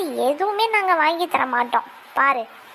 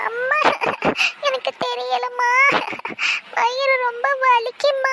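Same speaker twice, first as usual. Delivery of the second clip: put-on high, slow, whining child in pain; pained whimper; 5 semitones higher than usual.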